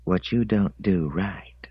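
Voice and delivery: unvoiced, slow intimate whisper. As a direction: breathe it into his ear. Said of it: "licked his ear and whispered in".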